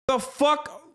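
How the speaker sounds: what on earth are they doing; incredulous outburst. Real, not acted. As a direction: pitch jumps up in outraged disbelief. Outraged at Special Olympics ban.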